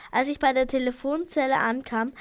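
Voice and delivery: ordinary delivery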